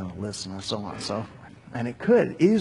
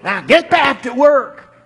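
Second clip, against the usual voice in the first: "Now get back to work." yelled much higher and louder; shouted command; 12 semitones higher, 10 dB louder than usual. Acted. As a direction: bark it with full fury. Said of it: "Pharaoh shouted".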